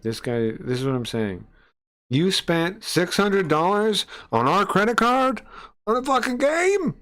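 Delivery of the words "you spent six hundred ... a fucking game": much higher, slow, mocking angry text; mocking shrill wife. Mocking the fiance's angry text about the game money, high and shrill. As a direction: squeal the fiance's line up high in mockery.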